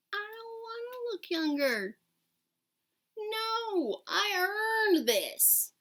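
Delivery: high, loud and indignant; proud, pushing back hard; defiant. Real, not acted. Defends her grey hair.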